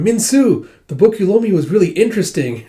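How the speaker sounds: put-on high, loud, eager little-kid voice; young child. Eight-year-old Suwon tells Minsu about a borrowed book, young child voice. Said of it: "with baby Suwon going".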